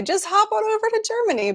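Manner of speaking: very high, mock-flippant lilt; mock breezy suggestion. Host jokingly advising British fans to travel, mock breezy. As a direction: toss it off in a high, teasing voice. Voicing her own glib suggestion.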